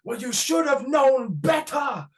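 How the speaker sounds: hammed-up Welsh scolding shout; broad Welsh accent. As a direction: boom it across the arena in the thickest Welsh accent. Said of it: "in the most Welsh accent".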